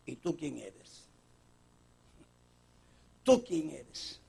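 soft, slow probing question; hushed questioning. Acted. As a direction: ask it quietly and weighty.

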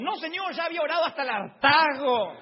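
high, loud exasperation; comic indignant shouting; fed-up protest. Retold or imitated. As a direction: belt out a hammed-up complaint. Protests having prayed to excess.

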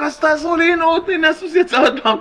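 very high-pitched, frantic plea; acted cry for help. Horse owner begging the crowd for help, crying out. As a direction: cry out as if nobody believes you. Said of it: "הוא מתחיל לצעוק".

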